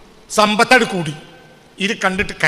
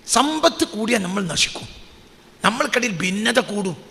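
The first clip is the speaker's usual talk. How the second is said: ordinary delivery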